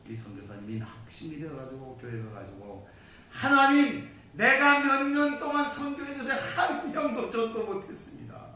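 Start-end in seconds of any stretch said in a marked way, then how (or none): 3.3-8.5 loud, high cry up to God; preacher acting out a despairing prayer; desperate complaint.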